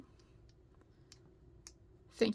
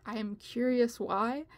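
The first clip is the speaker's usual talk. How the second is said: ordinary talk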